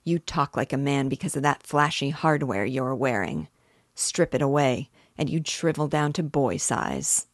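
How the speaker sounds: plain reading voice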